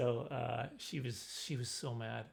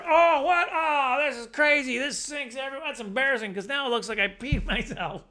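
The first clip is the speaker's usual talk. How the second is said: high, loud, shocked panic, laughing at the end; hammed-up yelp of alarm at the splashed pants; mock panic; 10 semitones higher, 8 dB louder than usual. Boy at the school sink panicking, high and loud.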